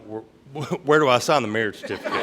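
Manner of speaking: fast, laughing through the words; laughing quote. Retold or imitated. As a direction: blurt it out fast while cracking up. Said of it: "I'm like".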